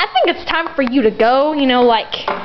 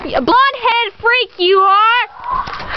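angry yell; 8 semitones higher, much slower than usual. Acted. Throwing the guest out.

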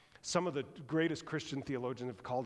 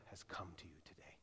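whisper; 9 semitones lower, 17 dB quieter than usual. Real, not acted. Quiet sermon climax.

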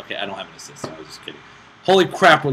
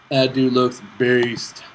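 slow, drawn-out and louder; "That dude looks beast" said loud and very slowly; slow emphasis; 5 dB louder, much slower than usual. Real, not acted. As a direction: savor each word, impressed. Repeats it aloud.

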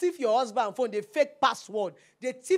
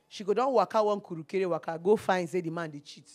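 ordinary delivery

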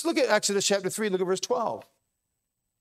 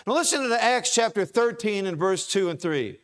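ordinary delivery

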